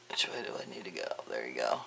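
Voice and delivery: fast whispered aside to herself; real whispered self-talk. Real, not acted. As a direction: breathe it out under her breath, quickly. Muttering to herself adjusting camera.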